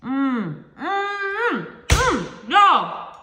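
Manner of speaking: rising, savoring hums of pleasure; mmm of delight. Real, not acted. Tasting her chicken taco for the viewers, delighted humming. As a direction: hum like the food is amazing.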